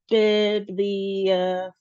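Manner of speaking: "bibliophile" slowly, each syllable drawn out; four separate syllables. Shown as a demonstration. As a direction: sound out "bibliophile" beat by beat. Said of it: "which is four syllables".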